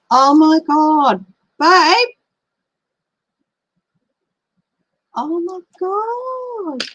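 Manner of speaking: stunned, can't believe what she's reading; shocked disbelief. Real, not acted. Shocked by news on screen.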